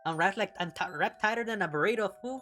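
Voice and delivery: mock Mexican accent. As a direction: play a comic Mexican mummy in a Mexican accent. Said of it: "Mexican mummy".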